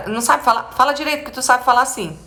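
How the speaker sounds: high-pitched imitated telling-off; scolding parent. Parent correcting child's jumbled speech, mock scolding. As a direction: nag like an impatient parent. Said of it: "Já ouvi até assim".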